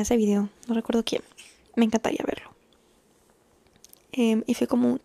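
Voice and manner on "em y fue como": usual speaking voice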